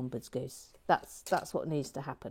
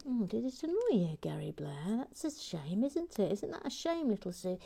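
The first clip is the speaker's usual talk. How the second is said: higher, swooping teasing pity; mock sympathy; 5 semitones higher, 4 dB quieter than usual. Host teasing annoyed Gary Blair to his sidekick, mock sympathy. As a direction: coo it with exaggerated false concern.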